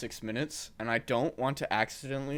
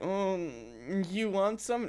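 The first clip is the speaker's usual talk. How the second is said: high-pitched, hesitant offer; tentative mock offer; 8 semitones higher, slower than usual. Coworker offering his hot sauce to John, mock tentative. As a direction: imitate a coworker doubtfully offering, voice raised high.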